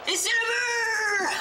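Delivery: high, slow goal call; goal shout. Pretend. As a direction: yell the goal.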